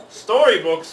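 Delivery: loud disgusted pirate bellow. A narrator's reading. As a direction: bellow it at the worthless haul. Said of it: "Pete bellowed".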